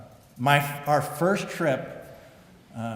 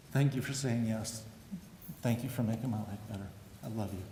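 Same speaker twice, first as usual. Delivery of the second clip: softer and softer, near tears of love; tender thanks; 8 dB quieter than usual. Real, not acted. Inductee thanking his wife from the podium, real tender hush. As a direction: speak gently to your wife.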